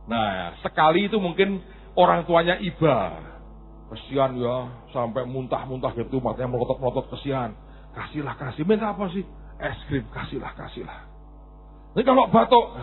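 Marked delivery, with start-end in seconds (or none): 3.9-11.1 pitying parent. Retold or imitated. Orang tua iba menyerah.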